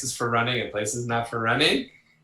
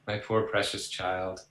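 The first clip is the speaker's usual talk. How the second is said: coddling parent; 4 semitones lower, 7 dB quieter than usual. Retold or imitated. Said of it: "we're not saying".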